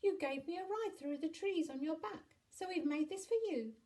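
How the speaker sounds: put-on high, loud child voice; high-pitched childish shout; shouting little boy. A narrator's reading. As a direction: call out excitedly like a small boy. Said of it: "shouted Jack".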